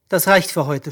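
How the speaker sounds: ordinary delivery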